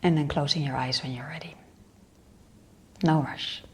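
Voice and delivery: unhurried meditation guide with long pauses; guided meditation. Real, not acted. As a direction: lead them inward in short, spaced-out phrases.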